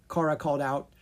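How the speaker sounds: usual speaking voice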